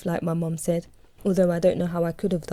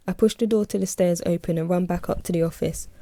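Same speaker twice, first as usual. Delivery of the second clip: ordinary delivery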